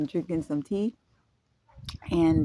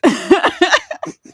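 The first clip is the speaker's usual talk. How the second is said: sudden high burst of laughter; bright embarrassed giggle; 12 semitones higher, 10 dB louder than usual. Real, not acted. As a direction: giggle loudly at yourself. Amused at own remark.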